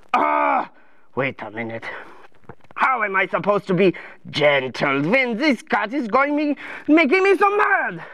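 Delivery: indignant cartoon royal; mad king. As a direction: protest building to an outburst.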